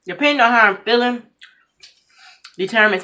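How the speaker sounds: chewing between words; talking while chewing. Real, not acted. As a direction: mouth partly full. Seen 3: Ate before; lips pressed and working while speaking early on.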